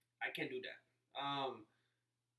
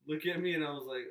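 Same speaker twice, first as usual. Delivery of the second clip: normal talking voice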